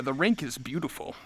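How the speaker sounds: ordinary talk, nothing marked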